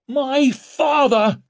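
high, loud, drawn-out outcry; impassioned, angry roar; angry bellow. A narrator's reading. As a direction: bellow it with the voice near breaking. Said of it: "he bellowed in an ever angrier and more broken voice".

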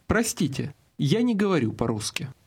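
normal talking voice

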